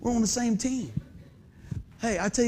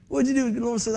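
ordinary talk, nothing marked

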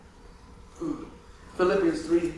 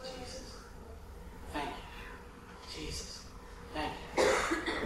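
much softer whispered prayer; breathless whisper; 3 semitones higher, 16 dB quieter, much slower than usual. Retold or imitated. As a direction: whisper the prayer with no air left. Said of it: "But I can still say".

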